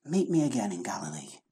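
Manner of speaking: soft, tender invitation; softly pleading Jesus; gentle Jesus voice. Acted. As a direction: coax them back quietly. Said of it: "Jesus says".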